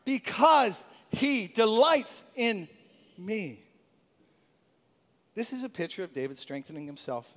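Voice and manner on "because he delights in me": slow, heartfelt declaration; spoken with the conviction of the heart. Shown as a demonstration. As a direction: say it like you truly believe it. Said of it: "say it with conviction of our heart".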